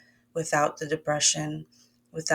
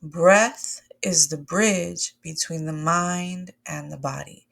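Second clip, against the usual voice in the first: slow, spaced-out mantra for the group to repeat; slow call to repeat; 3 dB louder, slower than usual. Shown as a demonstration. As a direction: stretch each word so they can follow. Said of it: "I need y'all to say that with me".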